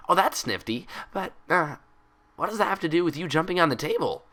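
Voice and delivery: put-on high, bouncy cheerful girl; bubbly Pinkie Pie voice. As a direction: chatter it out like an excitable pony.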